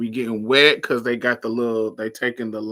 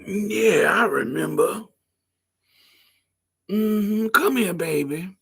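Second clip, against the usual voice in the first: put-on old lady voice; 8 semitones higher, slower than usual.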